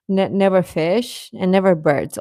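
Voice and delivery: normal talking voice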